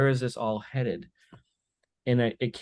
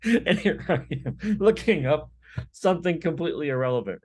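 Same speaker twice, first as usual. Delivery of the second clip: talking through laughter, higher; laughing; 7 semitones higher, 4 dB louder than usual. Real, not acted. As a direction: keep laughing as you say it. Still laughing at joke.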